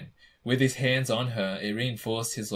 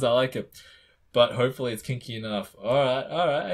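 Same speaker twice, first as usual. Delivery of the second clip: ordinary delivery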